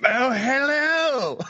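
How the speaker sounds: slow, high, laughing British hello; mock British Oh hello with a laugh; host challenged him to a British accent, slow laughing British greeting. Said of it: "do a British accent".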